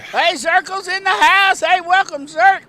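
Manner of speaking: excited welcome. Real, not acted. Announcer welcoming co-host Zirk on air, excited and loud. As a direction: greet your buddy like he just walked in.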